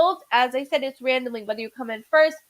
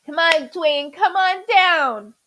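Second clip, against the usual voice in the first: drawn-out, louder game-show announcer call; game show host; 3 semitones higher, 5 dB louder, slower than usual. Pretend. Host calling a viewer on down, in a booming game-show voice.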